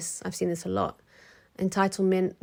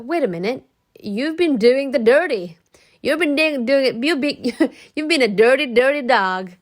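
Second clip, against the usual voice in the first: louder and higher, mock scolding; mock accusation; 5 semitones higher, 8 dB louder than usual. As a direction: call her out, teasing and loud. Calling out the cheater.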